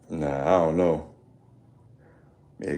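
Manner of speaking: ordinary talk, nothing marked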